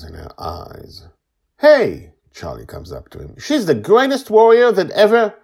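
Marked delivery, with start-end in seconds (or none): 1.6-2.1 high, loud protest; indignant shout. A narrator's reading. Charlie comes up to the Guardian, indignant shout. 3.4-5.3 high, loud protesting shout; indignant defence. A narrator's reading. Charlie defends Joy to the Guardian, indignant shouting.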